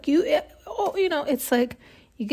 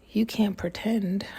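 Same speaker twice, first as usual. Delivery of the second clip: whisper; 5 dB quieter, slower than usual. Real, not acted. Quietly talking to herself.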